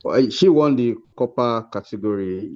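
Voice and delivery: usual speaking voice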